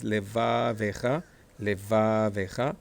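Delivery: "levavecha" slowly as le-VAA-vecha, rising at the end; stress on VA, short E. Shown as a demonstration. Teacher comparing two words for students, slow stress on VA. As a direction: hold VA and keep ve short in "levavecha". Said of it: "this is short A".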